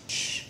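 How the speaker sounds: soft voiceless shush; hushing. Shown as a demonstration. Leader showing children a request for quiet, soft shush. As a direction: demonstrate being told to hush. Demonstrates being hushed.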